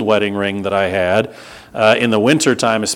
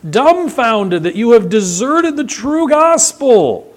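high, slow, incredulous apostle; high slow outraged astonishment; astonished Paul; 12 semitones higher, 3 dB louder than usual. Retold or imitated. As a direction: quote Paul stunned at the Galatians. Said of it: "Paul back there to the Galatians".

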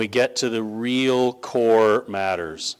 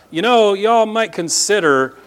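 higher, lighter mock of a timid suggestion; mock casual suggestion; 8 semitones higher, 4 dB louder than usual. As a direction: play it off as a limp maybe. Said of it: "That's not a".

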